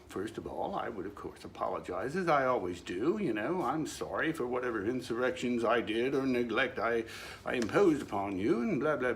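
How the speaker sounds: ordinary delivery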